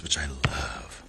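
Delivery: soft whispered aside. Real, not acted. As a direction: whisper it like a secret. Whispered aside about potatoes.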